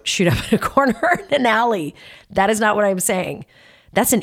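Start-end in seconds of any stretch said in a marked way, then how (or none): 0.0-1.9 higher, breaking into a laugh; laughing disclaimer. Real, not acted. Host disowns her own example of a bad impulse, laughing. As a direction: laugh through the words.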